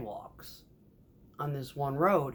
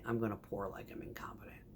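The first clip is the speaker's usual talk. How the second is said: softer, low whispered warning; whispered threat; 6 semitones lower, 11 dB quieter, faster than usual. Retold or imitated. Warning the contractor she'll pour badly, whispered. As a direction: let the threat slip out under the breath.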